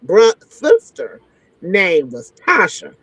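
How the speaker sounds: normal talking voice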